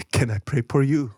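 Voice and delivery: polite mock Korean accent; imitated Korean accent. Storyteller imitates a Korean man offering prayer, Korean accent. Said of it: "So a Korean fob".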